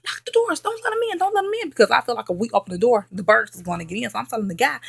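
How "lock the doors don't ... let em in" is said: much higher frantic commands; very high frantic lock the doors; panicked orders. Retold or imitated. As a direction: keep the birds out, frantic.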